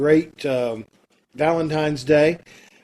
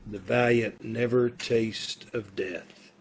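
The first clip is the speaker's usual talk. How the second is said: poem recitation; 5 semitones lower, 8 dB quieter than usual. Acted. Condemned man reciting Shakespeare before death, softly. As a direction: recite the verse quietly to yourself.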